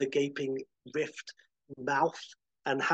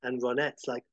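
ordinary delivery

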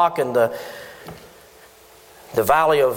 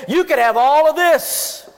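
mock taunting God; 6 semitones higher than usual. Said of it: "rub it in his face and to say".

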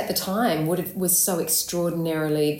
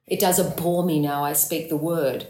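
disgusted verse line, dwelling on "abhor"; lengthened vowel; 3 semitones lower than usual. Acted. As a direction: stretch the "hor" of "abhor" in disgust. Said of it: "Really lengthening that vowel".

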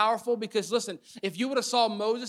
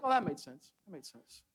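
mock unimpressed; 4 semitones lower, 7 dB quieter than usual. Imitating unimpressed onlookers.

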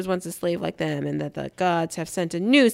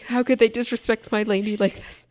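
higher, hammed-up wounded knight; a mock-tragic Jorah, higher and wailing; mock lament; 6 semitones higher, 3 dB louder than usual. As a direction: overplay Jorah's heartbreak. Voicing weeping Jorah.

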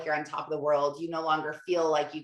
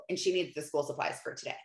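ordinary talk, nothing marked

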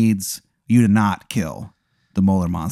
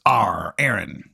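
pirate tomato; 3 dB quieter, much slower than usual. Acted. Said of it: "he's a pirate".

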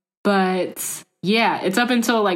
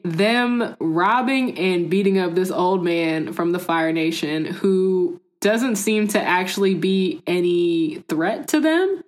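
ordinary talk

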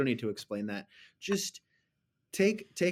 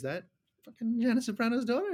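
mock gasp of total confusion; shocked disbelief; 11 semitones higher than usual. Podcaster retelling googling the actress, high shocked disbelief. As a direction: pitch jumps way up in disbelief.

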